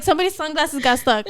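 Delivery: ordinary talk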